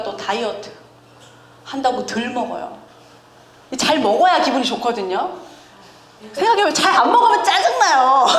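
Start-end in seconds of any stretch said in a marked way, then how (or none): 6.3-8.3 higher and louder through laughter; laughing. Real, not acted. Preacher laughs at dieting grumpiness, high and loud. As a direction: laugh as you say it.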